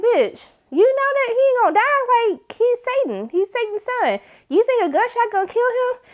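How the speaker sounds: high, disbelieving scolding; incredulous call-out. Retold or imitated. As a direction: ask her if she's serious. Yelling at character shooting Michael.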